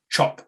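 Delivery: "Chop" for chip, i pulled back; New Zealand vowel. Shown as a demonstration. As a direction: model "Chop" as the Kiwi chip. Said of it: "perform with a New Zealand accent".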